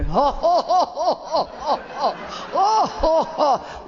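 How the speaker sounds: long, high, drawn-out mock laugh; awkward "ho-ho-ho" laugh in pulsing bursts; imitated uncomfortable laugh. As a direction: imitate a laugh that goes on far too long. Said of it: "he did the most uncomfortable laugh".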